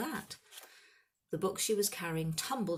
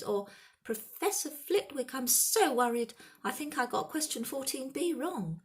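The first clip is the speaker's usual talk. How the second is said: mock high voice; 9 semitones higher, 5 dB louder than usual. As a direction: mimic a worried swot, pitched up. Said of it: "He put on a high voice".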